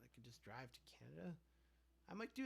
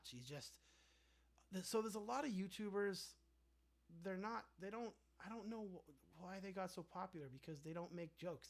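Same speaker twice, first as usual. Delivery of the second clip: ordinary delivery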